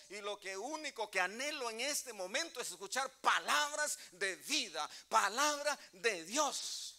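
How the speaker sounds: loud, fervent preaching to the congregation; rousing pulpit sermon voice; preaching at full force. Acted. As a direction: preach it out with passion, pushing every line.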